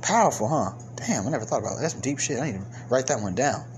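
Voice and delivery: low, soft musing to himself; mock awed aside. Creator muses on his own powerful line, mock awed aside. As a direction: drop right down into a hushed, self-impressed aside.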